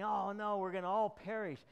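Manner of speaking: panicked disciples. Acted. Disciples panicking in the storm, loud frightened cry.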